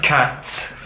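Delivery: Cats ending in a crisp hissed ts. Shown as a demonstration. Teacher showing the Pashto ts sound with an English word. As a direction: stress the ts at the end of Cats. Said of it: "The T S in cats".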